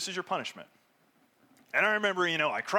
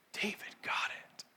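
whisper; 8 dB quieter, slower than usual. Real, not acted. Preacher drops to a hush.

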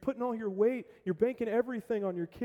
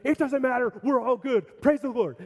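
much higher and louder, elated; giddy excitement; 6 semitones higher, 7 dB louder than usual. Acted. Dad shrugging off the dented fender, elated. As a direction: brush it off in pure joy. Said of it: "out of his excitement".

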